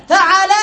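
فعل said as fa-ʕa-la; fatha on the ʕayn. Shown as a demonstration. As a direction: say فعل with "a" in the middle. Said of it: "بفتح العين".

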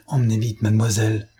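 ordinary delivery

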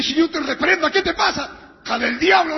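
high, loud retold rebuke; hoarse rebuke. Preacher rebukes the girls who scared him, hoarse shout. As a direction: blast the rebuke, hoarse and outraged. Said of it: "la voz ronca que tengo".

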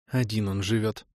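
softly sighed mother's line; a light sigh in the words. A narrator's reading. Mother sighs over her son living alone, sighing. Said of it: "вздохнула мама".

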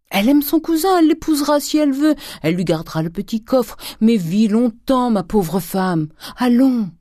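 high, loud, agitated urging; Grandet, frantic coaxing. A narrator's reading. Husband coaxing his fainting wife to live, frantic urging. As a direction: plead and promise in bursts, dropping at the end.

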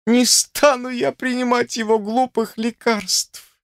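high-pitched, tearful refusal; sobbing outburst. A narrator's reading. Sister in tears refusing the doctor's medicine, bitter exclamation. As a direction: cry it out high, in bitter protest. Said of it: "воскликнула она".

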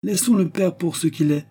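high-pitched, impatient cut-in; brusque interruption. A narrator's reading. Professor interrupts his pupil, brusque. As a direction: break in brusquely. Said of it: "interrompit brutalement le professeur".

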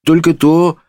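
high, small timid answer; timid innkeeper. A narrator's reading. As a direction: answer the judge meekly. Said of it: "робко отвечал трактирщик".

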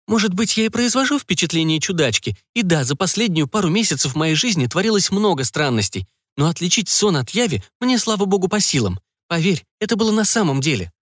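put-on a young woman insisting she isn't crazy; Emily's voice. A narrator's reading.

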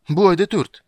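high, loud cry of alarm; exclaiming. A narrator's reading. Dryad shouts as the girl runs off, exclaiming. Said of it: "воскликнула Дриада".

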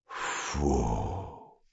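long, low exhaled phew; hushed sigh of relief; muttered relief. A narrator's reading. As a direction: let the breath out very softly. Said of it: "пробормотал диспетчер".

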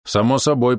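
plain reading voice

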